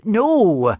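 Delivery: insistent. Acted. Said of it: "Erasmus insisted".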